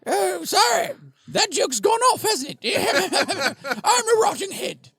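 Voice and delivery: high, loud Cockney squawk, laughing between lines; Monty Python Cockney. Acted. Rotting head excusing a bad joke, squawky Cockney. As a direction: play a daft, squealing Cockney head. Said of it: "Monty Python Cockney accent".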